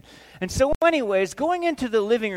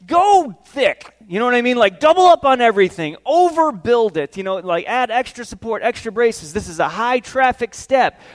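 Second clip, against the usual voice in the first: loud, higher, hammering out orders; emphatic insistence; 4 semitones higher, 9 dB louder than usual. Acted. Dad telling the builder how to build the step, emphatic. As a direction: urge him forcefully, punching key words.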